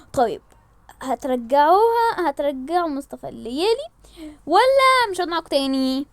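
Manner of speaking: ordinary talk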